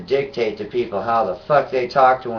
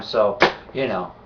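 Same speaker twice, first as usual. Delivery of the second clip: usual speaking voice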